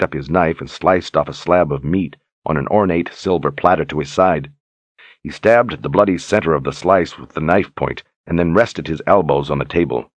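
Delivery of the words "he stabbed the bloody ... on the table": usual speaking voice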